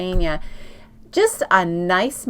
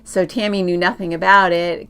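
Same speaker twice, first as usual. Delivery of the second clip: usual speaking voice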